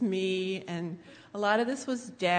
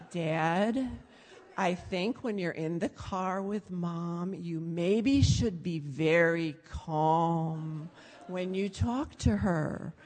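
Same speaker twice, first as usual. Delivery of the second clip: slow, measured re-enacted advice; very calm; slower than usual. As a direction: spread the words out to soothe an agitated parent. Daughter calmly advises screaming dad.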